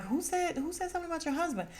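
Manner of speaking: high, fast squeaky mock of the gossiping cast; high, quick sing-song imitation of nosy cast members. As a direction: parrot them in a shrill put-on voice. Cast members mocked, asking who.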